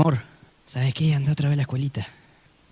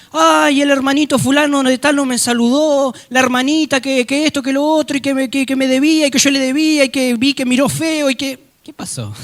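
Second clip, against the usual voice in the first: mock whiny complaint; 8 semitones higher, 4 dB louder than usual. Mocking a petty complaining believer.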